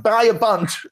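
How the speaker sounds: high, eager retold instruction; excited order. Boss tells purchasing people to order the cards, excitedly. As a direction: jump up in pitch, giving an eager go-ahead.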